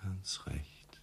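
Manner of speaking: a faint whispered agreement. Acted. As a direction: barely breathe it out. Uneasy reply during tense talk.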